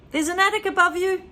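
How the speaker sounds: high, quick warning; boy warning his grandmother; George warning. Acted. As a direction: warn her fast, high voice.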